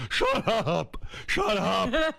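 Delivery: high, strained shout; yelling dad impression. Father silencing his excited child, high angry shout. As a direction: cut the kid off with Dad's holler.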